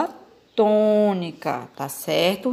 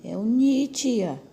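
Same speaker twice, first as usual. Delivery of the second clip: lightly voiced pupil, higher pitch; a hint of an eager pupil; pupil answering; 5 semitones higher than usual. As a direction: answer the teacher like a pupil who found it. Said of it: "Miguel respondeu pra mim".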